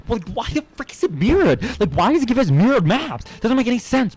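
high, loud, outraged complaining; exasperated rant. Real, not acted. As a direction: whine about the map in disbelief. Complaining about mirrored maps.

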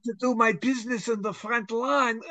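put-on high, loud, kvetching Yiddish accent, slowed down. Talking dog kvetches to its owner, in a loud, high Yiddish accent. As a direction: groan it out like an old Yiddish complainer. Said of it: "Do the accent".

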